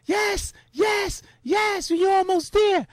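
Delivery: high, excited sideline cheering; cheering crowd. Retold or imitated. Crowd at the giant monitor cheering runners, high excited yells. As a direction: urge the runner on, high and bright.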